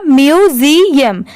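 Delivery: "म्यूजियम" slowly in parts, myu-zi-yam; syllable-by-syllable pronunciation. Shown as a demonstration. Teacher joining the spelled parts for learners, slow syllabic "म्यूजियम". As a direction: stretch out each syllable of "म्यूजियम". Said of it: "तो पूरा मिला के क्या हो गया?".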